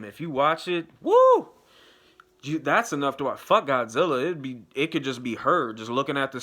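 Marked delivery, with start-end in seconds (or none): 1.0-1.5 high, loud "woo"; excited whoop. Real, not acted. Whooping over a gorgeous actress, loud and high. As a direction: let out a sudden high whoop.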